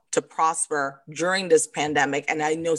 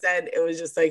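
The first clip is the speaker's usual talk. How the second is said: usual speaking voice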